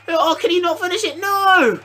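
high-pitched rising cry; anguished commentary. Real, not acted. Gamer watching his player fail to finish, high anguished cry. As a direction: build to a long high cry of dismay.

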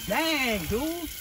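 lightly voiced butcher marveling at the clean carcass; impressed butcher. Imagined butcher praising the hunter's clean deer, mildly amazed. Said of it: "he's gonna be like".

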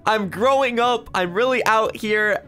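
put-on high-pitched, proud kid excitement; excited schoolkid. Kid on first day of school, excited. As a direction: play a child bursting to start school. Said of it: "I'm so excited".

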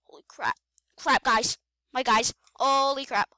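ordinary talk, nothing marked

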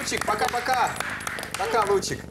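high, sing-song goodbye to a pet; cooing to a dog. Real, not acted. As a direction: coo goodbye like to a puppy. Host says goodbye to the dog.

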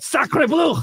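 high shouted Sacre bleu; French yell. Retold or imitated. Yelling at the patio crowd, high mock French shout. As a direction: cry it out like an outraged Frenchman. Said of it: "And then I yelled out".

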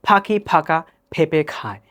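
Bengali words in an English accent, puffed p in পাখি, ফাঁকা, পেঁপে. Shown as a demonstration. Teacher contrasts languages for learners, English accent. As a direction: pop each p as in English. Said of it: "ইংলিশে বলি তাহলে হবে".

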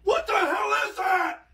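high, startled shout; shocked yell. Real, not acted. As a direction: cry out at a sudden hit. Grandson hit in face by prank.

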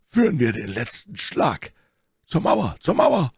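urgent rallying cry, climbing high; Athos. A narrator's reading. Athos urges his friends to the wall, shouting. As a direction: call them on, pitch rising to the end. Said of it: "rief Athos".

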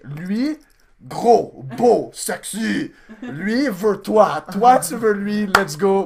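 loud, high, slow comic French; hammed-up matchmaking in halting French; mock translator pitch. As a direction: sell a friend in broken, drawn-out French. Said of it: "I was just like".

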